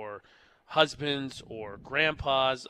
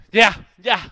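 flustered brush-off; 4 semitones higher, 12 dB louder than usual. Retold or imitated. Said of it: "I do one of those".

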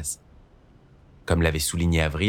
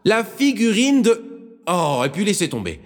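put-on high, loud old woman's voice, climbing to a squeak; a fussy old nun getting worked up; old nun, flustered; 12 semitones higher, 5 dB louder than usual. Old nun calling to Sister Marine, high and flustered.

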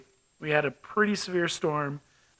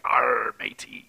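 pirate voice; 6 semitones lower, slower than usual. Retold or imitated. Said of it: "pirates are always like".

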